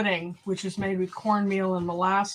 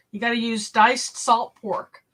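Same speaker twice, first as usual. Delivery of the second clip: ordinary talk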